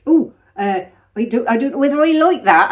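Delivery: loud mock Herefordshire farm voice; Herefordshire farmer accent. Joking about own wandering accent, hammed-up Herefordshire farmer. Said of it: "I sound like I'm from some Herefordshire farm round here".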